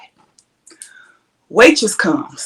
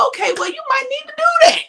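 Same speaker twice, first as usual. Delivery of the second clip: much higher, quick and mocking; faster than usual. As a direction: toss it off fast in a high squeaky mock. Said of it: "I was like".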